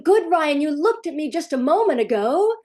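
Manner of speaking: frazzled parent forcing praise at a screaming son; mock frantic praise. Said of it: "and you're going".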